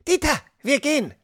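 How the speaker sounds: high, bossy call over her shoulder. Acted. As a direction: order the giant away without turning. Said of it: "rief sie".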